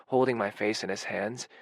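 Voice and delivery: plain reading voice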